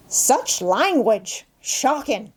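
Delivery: drawn-out Cockney scolding; slow, smug Cockney reproach; unabashed Cockney. A narrator's reading. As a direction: play a Cockney tut-tutting with slow, mock outrage. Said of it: "the Cockney was unabashed".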